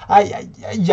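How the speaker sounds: high, loud aïe, aïe, aïe; shrill cry. A narrator's reading. Fanny shrieks during the play. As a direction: yelp it out. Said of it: "a hurlé Fanny".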